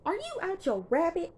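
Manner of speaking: high-pitched mock outrage; imitated indignant challenge; retold scolding. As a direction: scold him with the pitch shooting up. Retold mother confronting the father.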